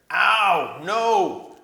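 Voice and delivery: loud, high pained dwarf bellow; dwarf bellowing from under the pile. A narrator's reading. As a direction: bellow it out in pain. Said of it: "bellowed Trumpkin".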